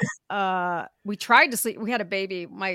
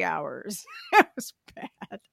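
laughing; slower than usual. Real, not acted. Laughing about hitting curbs.

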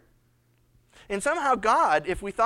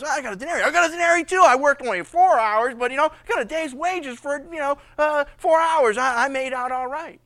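higher and louder, delighted; louder, higher happy boast; excited bragging; 5 semitones higher, 9 dB louder than usual. Acted. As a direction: gloat over a full day's pay. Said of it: "he goes".

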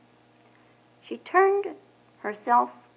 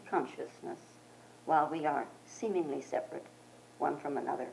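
benediction; 3 semitones lower, 5 dB quieter than usual. Acted. Closing blessing.

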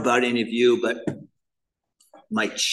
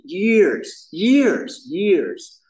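years, years, years rising higher and louder each time; building emphasis; 8 semitones higher, 6 dB louder, much slower than usual. Real, not acted. Telling the meeting how long he hid it, emphatic. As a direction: hammer years, years, years, climbing.

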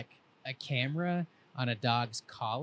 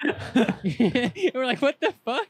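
high, loud laugh through the words; laughing disbelief; 12 semitones higher, 10 dB louder, much slower than usual. Real, not acted. Teller laughing in disbelief as he retells finding Say in the truck bed. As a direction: crack up while asking.

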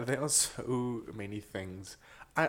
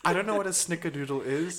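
higher, louder mock Minnesota accent; 7 semitones higher, 5 dB louder than usual. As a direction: play a puzzled Minnesotan. Continuing the Minnesota accent bit.

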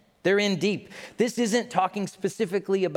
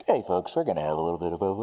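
lightly voiced pilot announcement, fast and casual; a hint of a breezy captain on the PA; pilot on the intercom; 9 semitones lower, much faster than usual. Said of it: "they come over the intercom".

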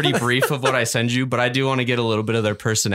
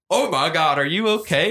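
higher, startled concern, imitating a bystander; lightly mock a shocked onlooker, pitched up; alarmed woman; 6 semitones higher than usual. Gas station woman to bloody, hungover man, mock alarm. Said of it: "And the woman's like".

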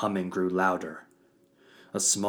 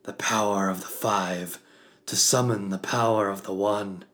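solemn invocation; 2 semitones higher, 6 dB louder than usual. Acted. Father leads the ritual invocation.

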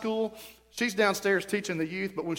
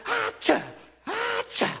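very high squeaky mock sneeze; imitated sneezes; slower than usual. Preacher imitating daughter-in-law's sneeze videos, high mock sneezes. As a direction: mimic a tiny high-pitched achoo. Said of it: "it's just sneeze after sneeze".

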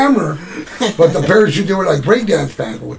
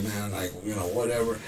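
mumbled brush-off; 8 semitones lower, 15 dB quieter than usual. Retold or imitated. Dave shrugging off the threat.

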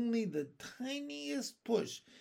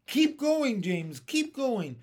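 high, loud cries of encouragement; excited shout that eases off; urging cheer; 8 semitones higher, 7 dB louder, slower than usual. A narrator's reading. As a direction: spur him on. Crowd cheering James on.

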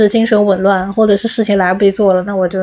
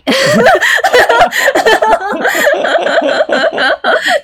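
long high laugh. Real, not acted. Laughs after thanking sponsor.

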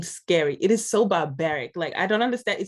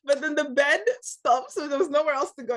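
usual speaking voice

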